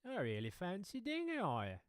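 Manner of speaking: high-pitched Aussie caricature; playful Australian wildlife-host voice; mock Australian accent. Shown as a demonstration. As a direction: coo at a creature in broad Australian. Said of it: "doing the Australian accent".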